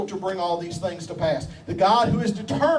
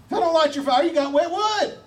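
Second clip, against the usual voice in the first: high, louder playful preaching; preacher punchline; 10 semitones higher, 4 dB louder than usual. Acted. Preacher teasing the congregation, playful high punchline. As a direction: throw the line out with a grin.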